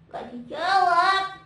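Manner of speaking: loud, high, stretched-out singsong greeting; Momon. Pretend. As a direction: sing it out slowly like an eager puppet.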